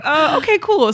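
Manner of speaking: high-pitched, laughing okay; laughing through it. Retold or imitated. The agency staff reacting to her crusty lucky sock, laughing. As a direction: quote them while cracking up.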